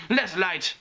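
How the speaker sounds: put-on high, brisk accented command; barked order. A narrator's reading. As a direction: snap an order at the crew. Photographer barks at lighting crew.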